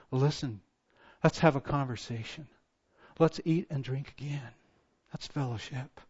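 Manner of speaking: soft, low, gentle invitation; God's tender voice. Acted. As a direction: coax them home quietly. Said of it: "here is God saying".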